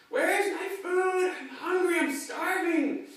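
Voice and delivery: high, put-on whiny old lady, drawn out; milk every complaint like a tragedy; mock outraged demand for food; dramatic starving grandma. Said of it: "on and on with the drama".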